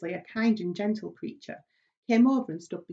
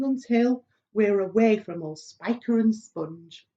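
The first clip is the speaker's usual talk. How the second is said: lively rhythmic poem recital; recited verse; 4 dB louder than usual. Acted. Centipede reciting his wild verse to the travelers, rhythmic. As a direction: chant it with relish.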